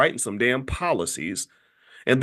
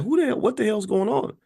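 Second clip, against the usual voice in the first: mock angry outburst; 8 semitones higher than usual. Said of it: "He got pissed".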